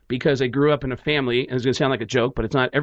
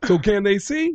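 Tracy Morgan; 4 semitones higher than usual. Retold or imitated. Comedian trying to understand deafness, oblivious questioning voice. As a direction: puzzle it through with dim sincerity. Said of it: "And he goes".

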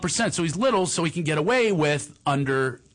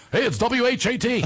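higher, upbeat radio DJ patter; 9 semitones higher than usual. Pretend. Kid in the trunk pretending to be the radio, upbeat DJ voice. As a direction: sell it like a station announcer. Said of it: "I'm doing it on my own D J voice".

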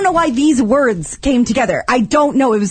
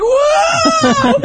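panicked yell. Retold or imitated. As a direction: yelp it like the car is spinning. Retelling freaking out in car.

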